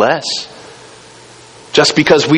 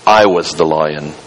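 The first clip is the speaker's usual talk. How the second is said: large deep voice; 7 semitones lower than usual. Acted. Lion reveals himself, low.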